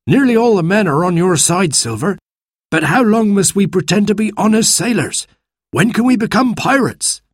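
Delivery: pushy pirate eager for action; impatient pirate. Acted. Third pirate voice.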